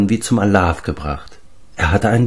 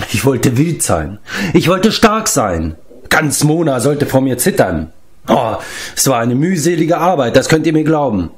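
high-pitched, self-pitying tale of ambition; giant Glev, plaintive; 6 semitones higher than usual. Acted. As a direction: recount it plaintively in a raised voice. (wehleidig erzählender Riese)

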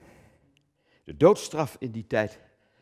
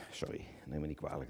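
quiet aside; 6 semitones lower, 16 dB quieter, faster than usual. Acted. Drops out of declamation apologetically.

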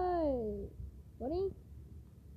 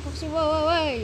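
shouting; 3 semitones higher, 7 dB louder than usual. Acted. Catching a cheater.

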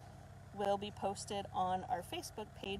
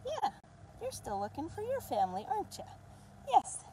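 puppy talk; 11 semitones higher than usual. Real, not acted. Cooing at a puppy.

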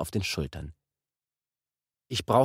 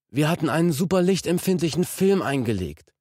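penitent voice; 9 semitones higher, 3 dB louder than usual. A narrator's reading. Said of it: "hauchte Orhun mit Büßerstimme".

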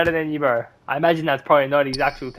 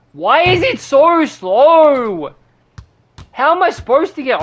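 very slow, high, loud whine; exasperated shouted moan; frustrated whine; 9 semitones higher, 7 dB louder than usual. Real, not acted. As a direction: drag out the complaint, much higher. Complaining about slow animation.

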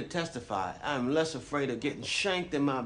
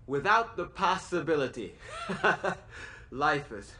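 higher voice breaking into a laugh; scornful laugh; 4 semitones higher than usual. Acted. Inmate narrator repeats the judge's sentence of life without parole, laughing scornfully. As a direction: repeat it incredulously, then laugh it off.

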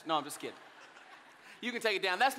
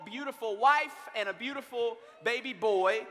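normal talking voice

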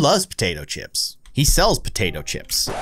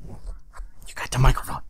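whisper; 6 dB quieter than usual. Real, not acted. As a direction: breathe it softly, no voice. Talking to child holding the mic.

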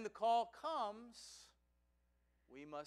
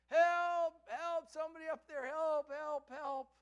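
high, loud cries for help; shouting for help; 9 semitones higher, 8 dB louder than usual. Acted. Man hanging from a branch shouts for help. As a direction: call up desperately for rescue. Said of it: "he just starts to shout for help".

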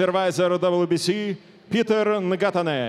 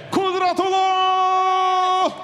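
high, slow booming name call; drawn-out name call; 12 semitones higher, slower than usual. Acted. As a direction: stretch the name out to the crowd. Ring announcer calls fighter name.

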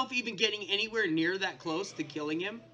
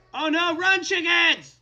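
high, loud mock-panicked yell; urgent shout; 7 semitones higher, 8 dB louder, slower than usual. Player warning chickens of the wither, urgent shout. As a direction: holler a frantic warning.

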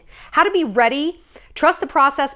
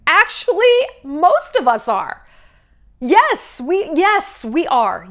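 emphatic agreement; 6 semitones higher, 5 dB louder, slower than usual. Real, not acted. Agreeing that everyone is a mess.

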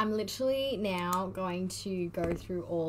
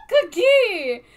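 high shrieking squeal of delight; fangirl squeal; 12 semitones higher, 17 dB louder than usual. Real, not acted. Fan shrieking at her quiz result, ecstatic squeal. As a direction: scream his name like a thrilled fan. Fan calls idol's nickname.

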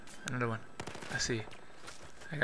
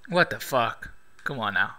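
ordinary talk, nothing marked

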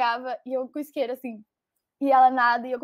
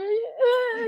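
tearful cry; 8 semitones higher than usual. Retold or imitated. A girl at the party starting to cry, voice breaking. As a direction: voice cracking into a sob, pitched up. Retelling crying over her ex.

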